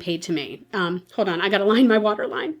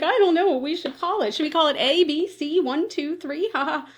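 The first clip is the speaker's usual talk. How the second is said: higher, lightly jokey doctor voice; joking doctor; 6 semitones higher than usual. Retold or imitated. Doctor joking with trainees about naming cysts, higher and jokey. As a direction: play it like a game show, lightly. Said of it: "kind of joking about what they should call it".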